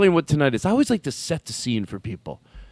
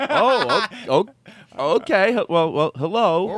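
nervous stammer; 6 semitones higher, slower than usual. Pretend. Playing nervous newcomer.

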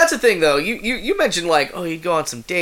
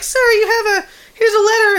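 high, squeaky mock falsetto; hammed-up high-pitched office underling; mailroom worker; 6 dB louder than usual. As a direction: pipe up in a much higher, louder put-on voice. Voicing Becky from the mailroom.

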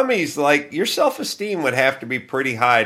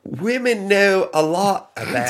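put-on Northern English accent, raised pitch; 6 semitones higher than usual. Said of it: "a little bit more of a Northern English accent".

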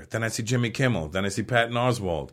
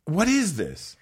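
dazed wonder; 6 semitones higher, slower than usual. Retold or imitated. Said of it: "I'm like".

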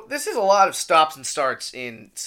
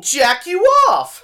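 shout; 11 semitones higher, 8 dB louder than usual. Pretend. Shouted line of the ad.

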